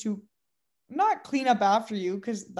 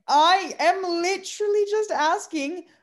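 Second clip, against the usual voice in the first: higher, slow, pointed exasperation; exasperated retort; 7 semitones higher, 5 dB louder, slower than usual. Retold or imitated. Barista answering a rude customer, slow exasperated and higher. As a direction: spell it out slowly to a rude customer, higher and louder.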